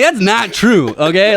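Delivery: high, loud retold protest; indignant protest. Employee protesting to his bosses over complaints, indignant. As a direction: push back loudly, pitched well up.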